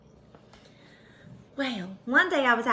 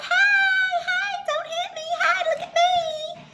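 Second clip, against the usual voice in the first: much higher, loud frantic calling; high excited shout; 5 dB louder than usual. A narrator's reading. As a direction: wave and yell at the truck. Waving at swerving truck.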